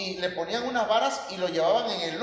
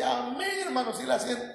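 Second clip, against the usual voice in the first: fervor imitado; 6 semitones higher than usual. Retold or imitated. Acting out insistent fervent reply.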